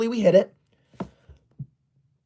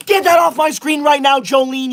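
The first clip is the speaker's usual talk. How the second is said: shouted command; angry shout; 4 semitones higher than usual. Acted. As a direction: bark the gift-sender off the screen. Shouting at a gift-sender.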